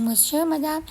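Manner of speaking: polite waiter's question, rising at the end; rising question tune. Shown as a demonstration. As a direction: ask "Madame" with a rising tune. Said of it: "แต่แค่ขึ้นเสียงสูง".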